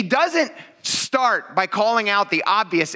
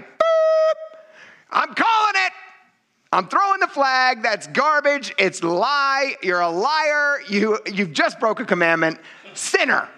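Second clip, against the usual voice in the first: mock referee call; 8 semitones higher, slower than usual. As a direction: call the foul like a ref, much higher. Referee throwing flag on sinner.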